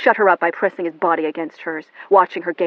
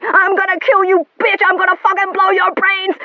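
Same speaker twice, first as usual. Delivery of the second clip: high, loud screamed threat; furious screaming; 13 semitones higher, 10 dB louder than usual. Acted.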